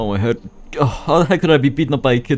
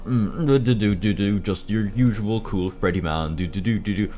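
cool guy voice; 5 semitones lower, 5 dB quieter than usual. Acted. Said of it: "time to become cool".

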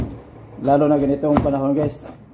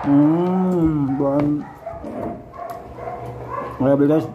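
talking while chewing; muffled by food; mouth full; much slower than usual. Real, not acted. Seen 0: Bites pineapple at start, then talks while chewing with mouth full.

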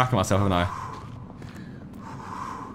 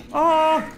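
frightened yelp; 12 semitones higher than usual. Real, not acted. Gamer caught by the creature, yelping high. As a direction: yelp as it catches up.

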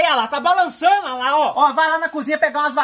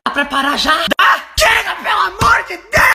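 fed-up rant. Acted. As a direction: yell it like you've snapped at the ads. Fed-up rant about TikTok ads.

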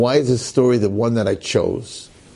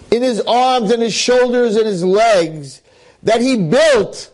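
building intensity; 10 semitones higher than usual. A narrator's reading. Preacher tells how Yankel lifts the wagon, rising intensity. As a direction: lift the story to its peak. Climax of the sermon story.

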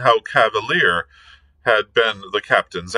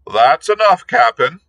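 high, loud, hearty call; cheery shout; 10 semitones higher, 6 dB louder than usual. A narrator's reading. Long John hails the captain, a loud cheery shout. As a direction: shout it out good-naturedly. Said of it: "shouted Long John cheerily".

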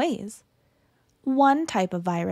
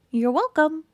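higher, smug little germ voice; cute germ voice; 4 semitones higher than usual. Acted. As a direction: toss it off like a proud germ. Germ thanks reader.

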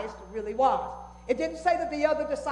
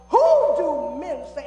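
voicing Jesus; 3 semitones higher, 8 dB louder than usual. Voicing Jesus's question, louder.

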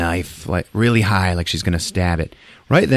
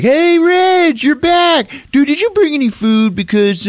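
high, loud mock cheer; excited villagers' greeting; 4 dB louder than usual. Villagers to returning Reg, high excited shout. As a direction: hail him in a hammed-up high voice.

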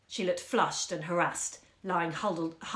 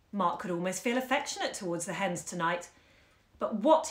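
ordinary delivery

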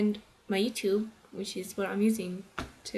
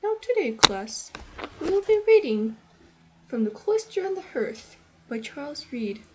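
lofty lecturer, much louder and higher; stuffy professor; 6 semitones higher, 6 dB louder than usual. Retold or imitated. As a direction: announce the reading like a pompous professor. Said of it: "he thinks it's like this".

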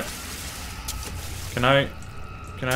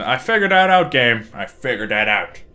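ordinary talk

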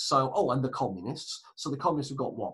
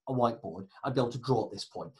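ordinary delivery

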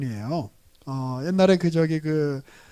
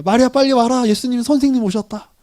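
louder, higher call across the house; 5 semitones higher, 6 dB louder than usual. Retold or imitated. Martha calling her sister Mary to come, raised call.

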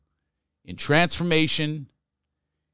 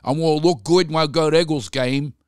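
broad, hammed-up Philadelphia vowels; Philly accent; 3 semitones higher than usual. Shown as a demonstration. Host talking up sunglasses for Eagles game, broad Philly accent. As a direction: lay the Philly accent on thick. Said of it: "this is how we talk in Philly".

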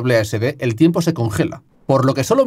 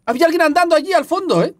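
much higher, fast alarmed report; shaken; 13 semitones higher, faster than usual. Real, not acted. Telling viewers what he saw, shaken. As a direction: rush it out still scared. Shaken after seeing someone.

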